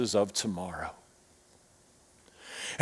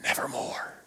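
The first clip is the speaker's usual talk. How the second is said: breathy whisper, barely voiced; whispered raven word; 11 dB quieter than usual. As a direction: whisper it like the raven's final verdict. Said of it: "The raven said".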